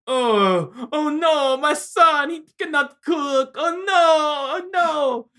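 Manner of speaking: high-pitched hammed-up lament; mock-tragic father wailing over his son; melodramatic wailing. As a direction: wail it slowly like an overwrought melodrama. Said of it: "that's so melodramatic".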